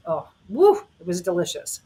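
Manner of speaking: a spontaneous "woo" of delight; real delighted reaction. Real, not acted. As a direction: savour the memory, with a whoop on woo. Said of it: "That was an authentic response".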